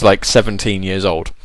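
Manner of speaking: ordinary delivery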